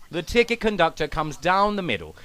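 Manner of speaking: usual speaking voice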